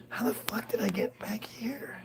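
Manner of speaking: whispered, bewildered; hushed disbelieving whisper; confused whisper. Retold or imitated. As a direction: whisper it to yourself in a panic.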